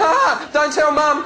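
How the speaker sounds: slow, high little-brother plea; mock pleading brother. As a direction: drag it out like a cheeky kid begging. Brother pleading in retold story.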